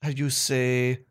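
halting put-on French, with long pauses; mock French accent. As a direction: grope for words as a comic Frenchman. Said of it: "I just like doing that accent".

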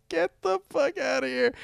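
high, hushed "no way" reaction; can't believe what he just read; stunned disbelief. Real, not acted. Host reacting to a listener's letter, stunned disbelief.

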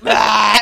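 loud blurted mock burp; sudden, very loud burp noise; imitated burp. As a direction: blast out an imitation of a big burp. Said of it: "Jordan before the episode was like".

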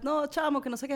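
high, lively Venezuelan chatter; Venezuelan slang imitation. Imitating herself talking Venezuelan in Mexico, high.

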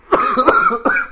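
choking cough after drinking; hard cough, went down the wrong pipe; coughing up water. Real, not acted. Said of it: "[name], are you okay?".

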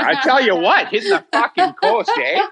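put-on high, laughing Canadian accent; hammed-up Canadian with a laugh. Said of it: "When I start doing a Canadian accent".

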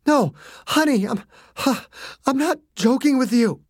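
high, strained and panting; father insisting he is serious, strained; urgent breathless plea. A narrator's reading. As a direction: insist through ragged breath.

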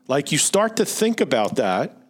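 normal talking voice

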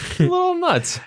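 very high falsetto aside; high-pitched teasing. Real, not acted. Host jokes about the unhinged pitcher, in teasing falsetto. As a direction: squeak it up high, jokingly.

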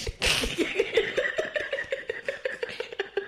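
long, high breathless laugh. Real, not acted. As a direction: crack up at your own story. Laughing at own story.

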